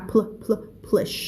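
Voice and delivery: "pl-, pl-, plush" with the pl cluster drilled. Shown as a demonstration. Teacher reminding her student of the pl cluster. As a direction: split and repeat the pl in "plush". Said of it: "อย่าลืมตรงนี้นะ พีแอล นะคะ".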